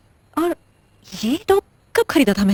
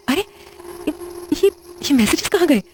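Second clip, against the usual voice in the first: higher, faster fear as evidence vanishes; panicked; 7 semitones higher than usual. Acted. Shruti finds her calls and messages gone, panicking.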